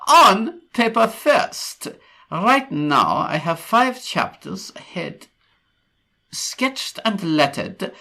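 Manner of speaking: slow, loud mock German accent; attempted German accent. Host reading a post, hammed-up German accent. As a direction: read it slowly and forcefully in a put-on German accent. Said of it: "I can't do a German accent".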